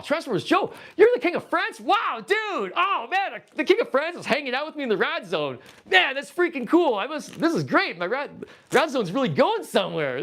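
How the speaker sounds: mock-awed dude cheering a chat comment; hammed-up excitement. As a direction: act thrilled that royalty joined your stream. Excited greeting of viewer's comment.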